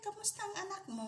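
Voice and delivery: high, quick cooing question; squeaky sing-song greeting. Real, not acted. Cooing at the mother animal.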